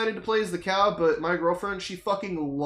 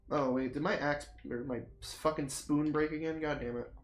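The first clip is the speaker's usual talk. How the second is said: muttered aside; 4 semitones lower, 10 dB quieter than usual. Real, not acted. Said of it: "in-game aside".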